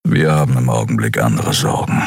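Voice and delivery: low, lightly voiced grumble; grumbling. Kero brushes off Xea, low grumble. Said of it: "brummte er".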